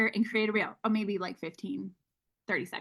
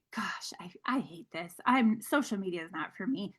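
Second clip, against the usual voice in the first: much softer self-critical mutter; embarrassed to herself; 4 semitones lower, 6 dB quieter than usual. Retold or imitated. Scolding herself on her first live, in a soft mutter. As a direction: mutter it under your breath, deflated.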